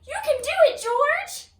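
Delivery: high, loud cheer with a cry in it; root for him on the hill; excited cheer. Acted. Reader cheering George down the hill, high and loud.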